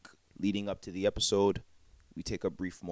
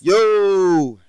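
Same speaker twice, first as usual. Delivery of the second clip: loud greeting; 12 semitones higher, 17 dB louder than usual. Real, not acted. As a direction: shout it out, high and loud.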